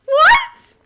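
high loud indignant yelling; outraged. Real, not acted. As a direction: protest in disbelief. Outraged at being killed.

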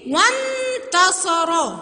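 "وانتصر" with a long held n; very slow wannn-tasara for "وانتصر"; jumping onto the noon. Shown as a demonstration. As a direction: skip the alef in "وانتصر" and hum on the n. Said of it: "لساني نط على النون على طول".

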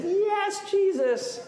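high, drawn-out mock cheer; crowd cheering. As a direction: imitate a parade crowd hailing him. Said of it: "It turned their cheers".

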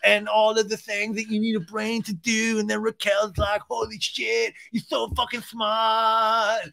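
high, slow dopey bro voice, building to a shout; hammed-up dumb guy boasting; mock Sandoval voice. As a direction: play him as a proud dim bro. Mock Sandoval rant, held high voice.

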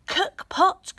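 put-on old woman showing the pot's magic words. A narrator's reading. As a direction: cast the spell on the pot.

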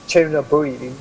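normal talking voice

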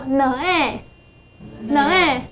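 "呢A" as two separate sounds, n then long A; sounding out. Shown as a demonstration. Teacher blending a new word for a pupil, sounding out. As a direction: sound out "呢A", a short nuh before the letter name A. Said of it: "所以这个字就念作".

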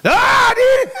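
very high, loud mock yell over headphones; oblivious shouting. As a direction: yell as if you can't hear yourself. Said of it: "אז הוא צועק". (צרחה מוגזמת של מי שלא שומע)

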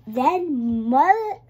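plain reading voice

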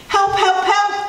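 high-pitched little cries; crying for help. A narrator's reading. Little truck stuck in mud, high cries for help. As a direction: call out urgently in a small high voice. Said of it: "cried the little blue truck".

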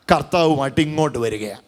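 fervent preacher, rising and falling; preaching. Acted. Preacher proclaiming to the congregation, fervent sermon.

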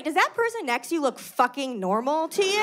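ordinary talk, nothing marked

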